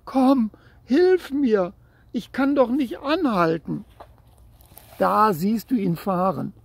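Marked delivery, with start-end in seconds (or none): 0.0-3.8 desperate plea. A narrator's reading. Boy begs his brother to stop the bike, high desperate plea. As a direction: beg for help, near tears. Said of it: "schrie er".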